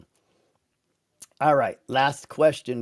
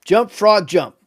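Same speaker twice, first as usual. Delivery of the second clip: commanding frog; 6 semitones higher, 8 dB louder, slower than usual. Acted. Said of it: "So he's like".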